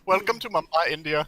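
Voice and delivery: loud put-on Indian accent; mock Indian accent. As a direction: ham up a Mumbai welcome. Friends mock Indian shop greeting.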